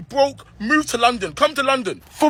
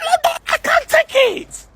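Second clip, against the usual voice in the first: laughing shout, pitched way up and slowed; laughing despair; slower than usual. Acted. Comedian wailing at London's prices, laughing shout. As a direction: howl it out half-laughing, half-breaking.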